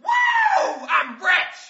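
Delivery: very high shouted whoop; gleeful cheer. Acted. Kid celebrating fifty cents, shrieking cheer. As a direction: celebrate the windfall.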